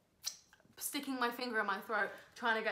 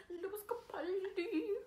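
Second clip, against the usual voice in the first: put-on Scottish accent; 8 semitones higher, 4 dB quieter, much slower than usual. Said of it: "your lip just quivered when you said that".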